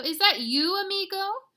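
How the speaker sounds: high, loud, rising question; excited exclaiming. Acted. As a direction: greet a long-lost amigo with glee. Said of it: "exclaimed Scapito".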